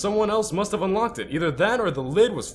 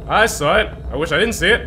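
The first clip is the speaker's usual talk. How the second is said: normal talking voice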